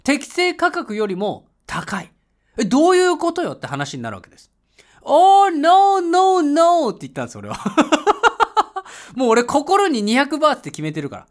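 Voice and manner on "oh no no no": high, loud, laughing "no, no, no"; hammed-up tourist refusing a fare; mock haggling refusal. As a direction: brush off the price with a big exaggerated no. Haggling over tuk-tuk fare.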